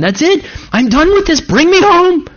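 wife in labor. Retold or imitated. Pastor voicing his wife in labor wanting it over, high and pleading. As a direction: voice the worn-out wife begging to quit. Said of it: "my wife ... saying".

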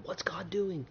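hushed fearful whisper; frightened whisper. Acted. Guilty brothers whispering to each other in fear. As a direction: breathe it out in guilty dread.

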